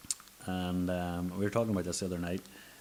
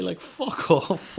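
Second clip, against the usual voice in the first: laughing while swearing, slow and high; 11 semitones higher, 7 dB louder, much slower than usual. Real, not acted. Laughing as he retells the cleaner's gaffe. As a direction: break into laughter mid-phrase.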